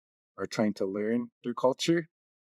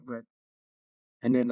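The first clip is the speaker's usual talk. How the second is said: ordinary talk, nothing marked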